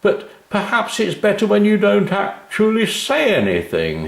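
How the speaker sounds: high, hearty jovial voice of a friendly man; a jolly businessman amused; cheery big man. A narrator's reading. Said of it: "he smiled".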